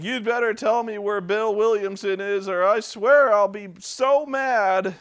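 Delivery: high, loud put-on voice making a limp threat; mock a hero who only talks tough; whiny threat. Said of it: "he's like".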